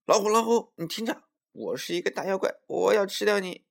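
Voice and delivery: high, loud squirrel posing as a monster; loud shouting. A narrator's reading. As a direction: call out a mock-menacing threat, high-pitched. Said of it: "它大声叫起来".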